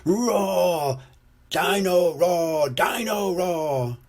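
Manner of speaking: high, slow, drawn-out toy roar; toy dinosaur blaring. A narrator's reading. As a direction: blare it out like a noisy toy. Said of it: "Dino Roar comes to life".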